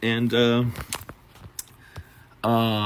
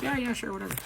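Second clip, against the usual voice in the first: dismissive brush-off; 12 semitones higher, 5 dB quieter than usual. Retold or imitated. Friend brushing off the request.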